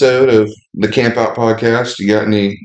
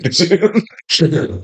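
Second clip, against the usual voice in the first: higher mock-shocked repeat; mock outrage; 6 semitones higher than usual. Real, not acted. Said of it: "That's a little too soon".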